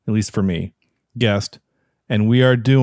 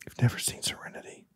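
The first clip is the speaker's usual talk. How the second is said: shocked whisper; 14 dB quieter than usual. Real, not acted. Shocked friend hasn't seen it.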